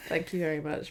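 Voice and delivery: ordinary delivery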